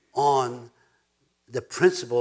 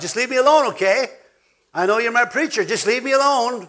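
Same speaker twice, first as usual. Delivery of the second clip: high, loud mock churchgoer pleading to be left alone; imitating a member who wants no preaching; whiny brush-off; 8 semitones higher, 7 dB louder than usual. Church member telling the preacher to back off, high mock whine.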